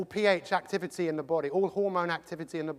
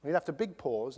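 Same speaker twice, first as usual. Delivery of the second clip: ordinary talk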